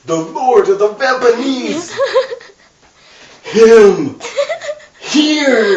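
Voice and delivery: hammed-up shocked gasp, laughing. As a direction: gasp it out in disbelief. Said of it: "Vancha gasped".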